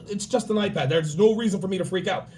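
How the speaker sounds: ordinary talk, nothing marked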